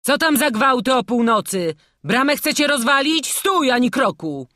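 loud, high commanding shout; calling out. A narrator's reading. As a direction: halt them with a captain's shout. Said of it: "zawołał rotmistrz ceklarzy". (donośny okrzyk rotmistrza)

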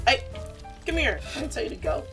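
ordinary talk

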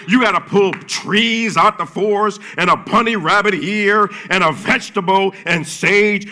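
loud, high preaching build; rising to a shouted peak. Real, not acted. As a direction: bring it home to the crowd. Preaching climax at full force.

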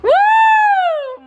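high loud woo; excited cheer. Real, not acted. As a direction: whoop with joy. Cheering shooting toy.

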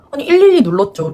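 mock-indignant retort, punched out; retold defensive retort. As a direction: act out talking back in an argument. Acted argument with police.

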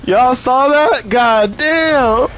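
stunned, drawn out; surprised. Real, not acted. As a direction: wow at the game. Startled by game event.

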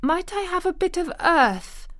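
put-on high, quavering little girl's plea; timid but eager, pitched high. A narrator's reading. Girl asking for a bit of earth, quavering. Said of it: "quavered Mary".

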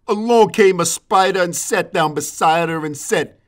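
ordinary talk, nothing marked

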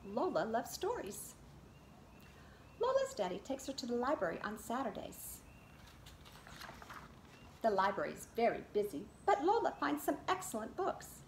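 lively read-aloud picture book voice; reading to children. Acted. Teacher reads Lola Loves Stories to her class, in a lively storybook voice. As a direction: read it to a circle of little kids.

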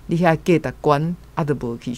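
usual speaking voice

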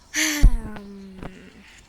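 long, loud exasperated sigh; heavy sigh. Real, not acted. Gamer fed up with the loot, heavy exasperated sigh. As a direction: let out a big weary sigh.

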